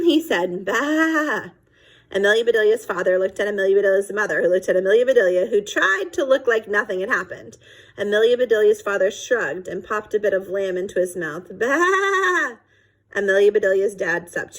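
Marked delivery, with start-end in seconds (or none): 0.6-1.5 bleat. A narrator's reading. Sheep bleat heard at dinner. 11.6-12.6 high bleating "Bah!"; sheep bleat. A narrator's reading. Sheep bleat outside window.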